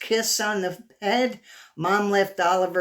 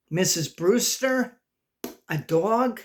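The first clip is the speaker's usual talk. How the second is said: ordinary delivery, nothing marked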